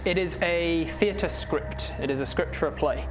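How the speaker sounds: ordinary delivery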